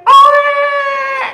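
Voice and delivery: loud, very high cry of Oleh; joyful shout. Acted. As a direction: burst out with the news. Bursting out with the news.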